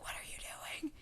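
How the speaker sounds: hushed whisper to a classmate. Retold or imitated. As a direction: ask under your breath so teachers won't hear. Nosy classmate at school.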